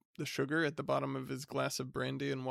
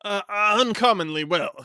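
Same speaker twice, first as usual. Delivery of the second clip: flustered, high, loud stammer; polite stammer; 6 semitones higher, 10 dB louder than usual. Acted. Flustered bachelor greeting his unwanted cousin with a stammer. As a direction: stumble over the greeting, flustered. Minns strained reply.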